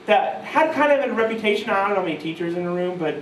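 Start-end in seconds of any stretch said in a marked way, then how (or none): none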